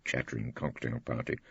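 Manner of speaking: ordinary delivery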